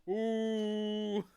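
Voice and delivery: high, loud, drawn-out "ooh"; sustained hoot at a risky joke; mock-shocked ooh. Real, not acted. Streamer reacting to his own edgy joke, mock-shocked ooh.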